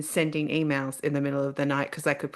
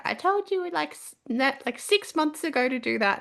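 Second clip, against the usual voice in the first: told-you-so husband; 11 semitones higher than usual. Retold or imitated. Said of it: "And my husband's like".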